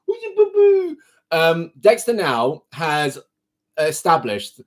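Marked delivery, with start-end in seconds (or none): 0.1-1.0 much higher, squeaky coo. Real, not acted. Cooing at the kitten in a much higher squeak. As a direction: squeal it up high at the cat. Baby talk to the cat.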